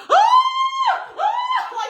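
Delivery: very high startled screams; re-enacted scream. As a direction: scream as the spider leaps. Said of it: "So then again, I'm like".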